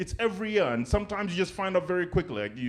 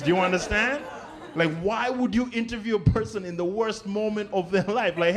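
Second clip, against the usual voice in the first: ordinary talk, nothing marked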